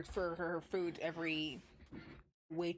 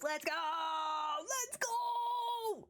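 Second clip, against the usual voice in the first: hype shout; 10 semitones higher, much slower than usual. Real, not acted. Streamer rallying chat to finish the game, high excited shout.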